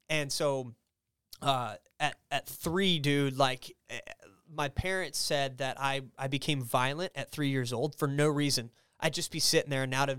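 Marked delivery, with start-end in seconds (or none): none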